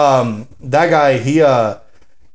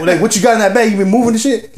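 higher-pitched confrontational grilling; talking shit; 7 semitones higher than usual. Retold or imitated. Dude grilling a guy about his bag, confrontational. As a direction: grill him like you own the block. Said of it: "talking shit".

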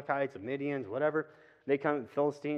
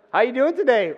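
cheery greeting; 10 semitones higher, 13 dB louder than usual. Retold or imitated. Greeting couple at church door.

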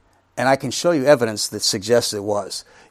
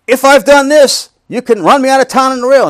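loud public declaration; 11 semitones higher, 8 dB louder than usual. Shown as a demonstration. Preacher proclaiming to an empty classroom, loud and high. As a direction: call it out to a crowd that isn't there. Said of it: "you stand up in front of a classroom".

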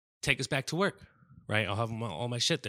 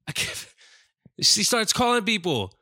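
high, loud words broken by a laugh; laughing through it; 9 semitones higher, 6 dB louder than usual. Real, not acted. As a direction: laugh as you try to get it out. Laughing while telling story.

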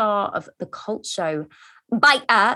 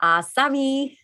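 attempted Geordie, "sorry" said as "savvy"; higher mock Geordie catchphrase; bad Geordie impression; 4 semitones higher, slower than usual. Host mimicking guest's catchphrase, bad Geordie impression. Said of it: "That's how bad my impression is".

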